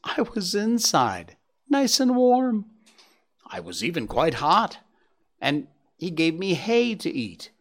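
lightly voiced boastful young deer; proud youngster showing off his tale; Gobo the young deer. Gobo tells of winter with man.

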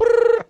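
high, loud trilled "brrr"; vocal ringtone; mimicked phone ring. Imitates a phone ringing.

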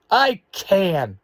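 high, loud insistence; indignant shout. A narrator's reading. Little sister insists as siblings laugh, indignant shout. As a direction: shout it back in protest. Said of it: "she shouts".

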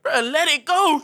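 mock-impatient yell; exasperated urging. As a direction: push him to do it, loudly. Urging his sick brother on.

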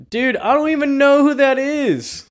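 high mock Clark, laughing. Clark denying he knows the doctor, high laughing mock voice. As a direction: protest innocence in a high squeak. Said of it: "Clark's like".

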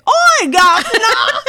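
high, loud, hammed-up mock English; annoying English accent. Neighbors reacting to England trip news, imitated annoying English accent. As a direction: squawk it in a fake English accent. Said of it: "got an annoyingly English accent back".

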